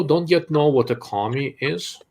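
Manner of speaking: normal talking voice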